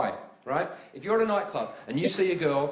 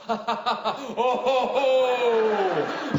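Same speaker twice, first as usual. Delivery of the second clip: audience laugh mimic; 4 semitones higher, 5 dB louder than usual. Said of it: "the audience always go".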